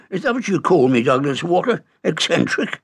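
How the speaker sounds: put-on a huffy old uncle taking offence; indignant. A narrator's reading. Uncle bristling at being called eccentric, indignant. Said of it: "said Montgomery Jones indignantly".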